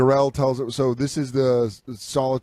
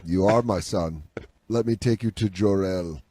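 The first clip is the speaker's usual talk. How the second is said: mock solemn father; 6 semitones lower than usual. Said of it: "And he goes".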